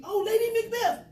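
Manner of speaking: light up with the answer; excited realization. Retold or imitated.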